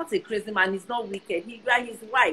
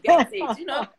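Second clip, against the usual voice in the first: high, loud teasing come-on; playful flirt; 7 semitones higher, 7 dB louder than usual. Retold or imitated. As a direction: tease him, loud and bright.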